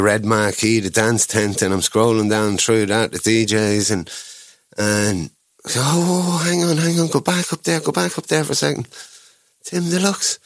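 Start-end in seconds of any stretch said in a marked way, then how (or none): 5.8-10.4 high, excited oh, hang on, go back; retelling the thrill of spotting the name; excited discovery. Retold or imitated. Telling his wife to scroll back, high and excited.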